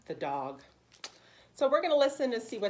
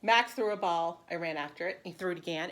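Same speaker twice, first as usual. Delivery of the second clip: bright, lively read-aloud for preschoolers; animated picture-book narration; storybook reading; 7 dB louder than usual. Acted. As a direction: read it like story time for little kids. Teacher reads picture book aloud.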